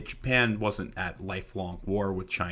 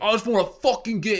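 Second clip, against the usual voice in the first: put-on fast, much higher, loud drunken rant; drunk British lad; 14 semitones higher, 9 dB louder, faster than usual. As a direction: slur it out fast like a wasted lad. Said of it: "And he's like".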